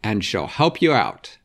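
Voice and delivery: bossy Marmalade. Acted. Marmalade bossing Vera by phone.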